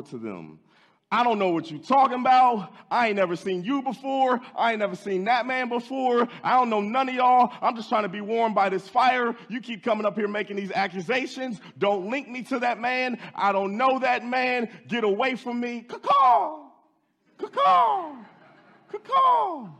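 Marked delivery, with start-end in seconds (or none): 1.1-15.8 furious high shouting; cursing denial. Acted. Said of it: "he begins to call down curses". 15.8-19.7 high shouted mock rooster crow; preacher imitating the rooster's crow, very high and loud; rooster crow imitation. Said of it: "that's how a rooster sounds".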